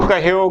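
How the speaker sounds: ਕਹਿਓ run together as "kaheo"; wrong reduced form. Shown as a demonstration. Teacher showing the slip, mispronunciation. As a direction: end ਕਹਿਓ on a plain o. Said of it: "ਗਲਤ ਉਚਾਰਨ ਹੋਵੇਗਾ".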